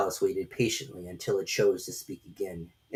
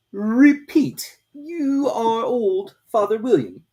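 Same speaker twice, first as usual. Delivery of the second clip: very slow, loud, strange drawn-out voice; slow caterpillar drawl; 13 semitones higher, 7 dB louder, much slower than usual. A narrator's reading. Caterpillar lazily questioning Alice, very slow and loud. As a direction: stretch every word lazily, from on high.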